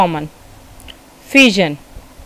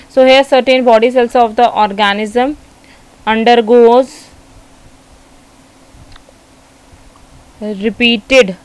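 slow dictation with long pauses; measured classroom dictation; dictating notes; much slower than usual. Real, not acted. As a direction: dictate it phrase by phrase for note-takers.

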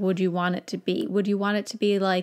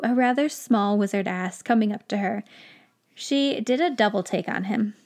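ordinary talk, nothing marked

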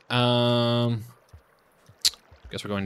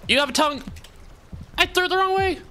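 exasperated yelling. Real, not acted. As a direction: squeal at the mistake. Frustrated by game mishap.

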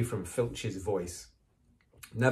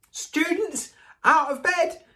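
high, loud alarm call; bellowing; 8 dB louder than usual. Acted. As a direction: raise the whole castle. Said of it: "Peeves bellowed".

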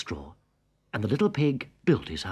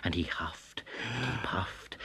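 whispered huff; 3 semitones lower, 9 dB quieter than usual. A narrator's reading.

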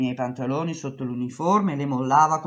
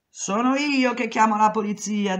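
angry boss; 7 semitones higher, 3 dB louder than usual. Retold or imitated. Boss shouts back.

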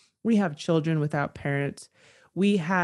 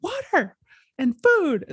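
high imitated demand, pushed louder at the end; mock yell; 11 semitones higher, 4 dB louder than usual. Screaming man telling passerby his needs, high mock yell. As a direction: act out yelling a need. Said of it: "he's just screaming at nobody".

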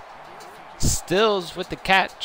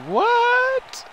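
high, squeaky surprised cry; squealed what; 4 dB louder than usual. Real, not acted. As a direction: squeal it in disbelief.

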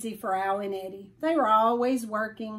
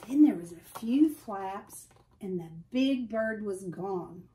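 plain reading voice

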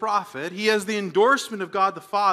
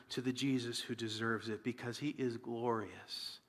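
reverent whisper; 9 semitones lower, 13 dB quieter than usual. Real, not acted. Preacher hushes the congregation in reverence, whispering. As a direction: drop to a soft hushed whisper.

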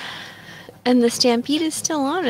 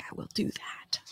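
whisper; 13 dB quieter than usual. Retold or imitated. Stepdad's reply whispered.

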